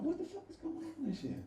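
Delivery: half-whispered confusion; bewildered. Retold or imitated. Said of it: "I'm like".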